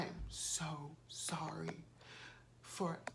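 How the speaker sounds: whispered apology. Retold or imitated. Driver apologizing at lunch, imitated slow whisper. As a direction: breathe the apology, barely voiced. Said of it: "he goes".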